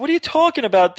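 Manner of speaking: high-pitched, incredulous question; retold bewilderment. Bewildered reply to yelling parents.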